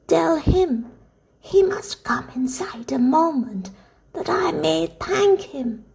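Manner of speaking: put-on slow, high, whispery old woman's voice; eager old grandmother. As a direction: urge it slowly in a frail old woman's half whisper.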